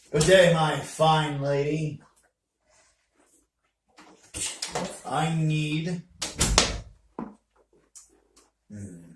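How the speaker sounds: dwarf voice. Acted. Player voices dwarf customer.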